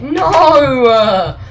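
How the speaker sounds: loud, high, drawn-out cry of defeat; mock-upset wail. Gamer lamenting his death in the game, mock-upset wailing. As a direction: wail it out at the top of your voice. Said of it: "I'm actually really upset".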